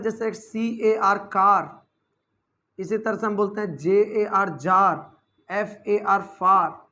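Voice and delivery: "कार" spelled slowly with a long open aa; slow spelling demo. Shown as a demonstration. Teacher spelling car and jar slowly for students. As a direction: spell each letter, land on "कार" with a long aa. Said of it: "आ का भी sound होता है".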